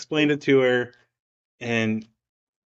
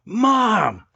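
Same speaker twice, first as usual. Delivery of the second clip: put-on hollered high and loud like an excited kid; imitating a kid's high, proud yell; too loud; 12 semitones higher, 3 dB louder, slower than usual. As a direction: call it out way louder than needed. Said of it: "Way louder than what he needed to".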